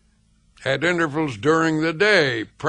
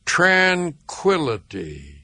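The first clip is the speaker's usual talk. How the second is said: tranquility drawn out slowly, syllable by syllable; slow, peaceful word; slower than usual. Shown as a demonstration. Reader offers listeners a peaceful quieting word to repeat, said slowly. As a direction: stretch out tranquility, unhurried and measured. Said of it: "Repeat the word slowly".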